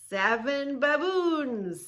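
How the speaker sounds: slow, high and loud title call; dramatic announcing. Shown as a demonstration. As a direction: announce the animals grandly. Dramatic reading of book page.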